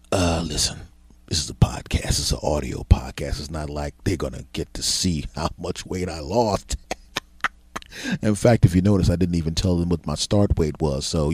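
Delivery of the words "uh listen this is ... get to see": conspiratorial whisper off mic. Acted. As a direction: whisper as if the recording were paused. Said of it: "could you hit the pause button".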